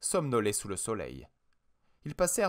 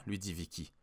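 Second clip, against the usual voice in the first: usual speaking voice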